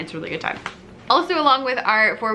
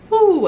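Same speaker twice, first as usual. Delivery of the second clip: high, loud owl "woo"; owl hoot; 12 semitones higher, 8 dB louder than usual. Shown as a demonstration. Music teacher modelling an owl sound for singing, high hoot. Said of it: "what do owls say?".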